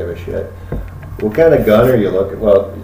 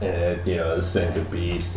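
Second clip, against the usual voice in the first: normal talking voice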